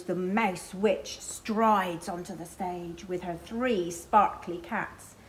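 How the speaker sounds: usual speaking voice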